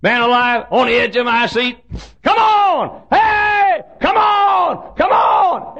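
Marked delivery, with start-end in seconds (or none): 2.2-5.8 high crowd yell; hollering. Retold or imitated. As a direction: yell it like a fan at a close game. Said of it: "Everybody was hollering that".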